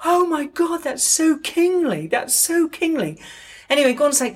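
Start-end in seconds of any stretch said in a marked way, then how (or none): 0.1-3.2 much higher, gushing and awed. Retold or imitated. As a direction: swoon over the landlord like a fan. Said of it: "so [name]'s like".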